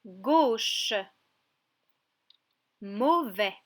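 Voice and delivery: "Gauche" and "Mauvais" stretched, O held long; syllable by syllable. Shown as a demonstration. Teacher reads two words to a child, drawn out. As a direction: read each word slowly, on its own. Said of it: "Je te les lis".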